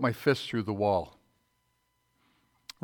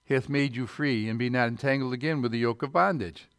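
loud, raised preacher's reading; scripture reading; 3 semitones higher, 3 dB louder than usual. Acted. Preacher reading scripture to the church, loud and raised. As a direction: declaim the verse to the congregation.

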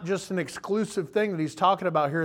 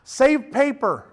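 exasperated reader; 5 semitones higher, 4 dB louder, slower than usual. Retold or imitated. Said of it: "You're like".

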